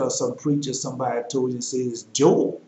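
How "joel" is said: "Joel" drawn out as Jole; Joel as one syllable. Shown as a demonstration. Preacher quotes what teachers told you, "Joel" as Jole. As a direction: say "Joel" slowly, rhyming with coal. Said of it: "that's how you pronounce it".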